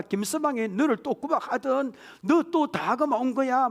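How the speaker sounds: high, heated mother taking her daughter's side; indignant mother. Acted. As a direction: huff on the daughter's behalf. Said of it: "엄마가 뭐랍니까?".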